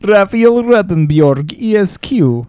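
Scandinavian twist on Ruttenberg as "Ruttenbjorg"; Scandinavian accent. Shown as a demonstration. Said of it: "[name]. sounds Scandinavian".

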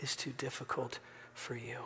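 hushed, just loud enough to hear; whispered prayer. Shown as a demonstration. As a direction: pray it softly under your breath. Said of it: "just loud enough for you to hear".

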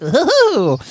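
zany, high hoot; a high, silly put-on "ooh"; Riddler. The Riddler getting zany with Bane, hooting high. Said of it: "he gets real zany".

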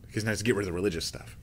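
fast whispered aside. Acted. Preacher whispers a quick aside to the congregation. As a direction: drop to a quick whisper, as if sharing a secret.

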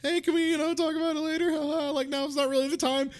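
mock gentle husband. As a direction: play it light and polite. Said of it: "instead of Adam Sandler being like".

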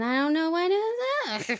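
high, slow bewildered whine; helpless mock. Cohost imitating herself on the phone, high slow whine. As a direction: play the confused caller, drawn out high.

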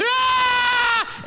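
high imitated wailing scream; two people screaming. Husband mimicking wife and baby in the night, screaming. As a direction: mimic screams heard in the dark. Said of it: "I've got two of them screaming".

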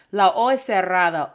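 normal talking voice

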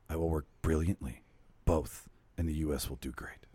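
low, seductive read; sexy voice. Acted. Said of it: "Okay, I'll go to my sexy voice".

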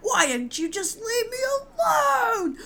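put-on high, wailing teenage tantrum shout; melodramatic yelling. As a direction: wail it like a slammed-door tantrum. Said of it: "yells at her whole family".

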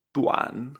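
TUAN stretched, dipping then rising; pronunciation demo. Shown as a demonstration. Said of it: "Esto se pronuncia".

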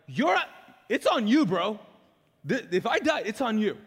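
loud, high-pitched mock confrontation; blatantly honest. Believer telling Jesus off before sleep, blatantly honest challenge. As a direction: hammer the blame home at full voice. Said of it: "blatantly honest".

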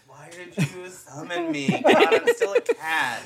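slow, drawn-out mock annoyance; annoyed genie cat. Playing a cat genie summoned from a bottle, really annoyed. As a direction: whine slowly, climbing high in exasperation. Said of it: "Being really annoyed when you open".

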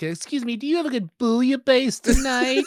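mock a nerdy diner, half-laughing; dweeb voice. Teasing a friend about his restaurant order, in a prissy dweeb voice. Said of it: "I was doing a dweeb".

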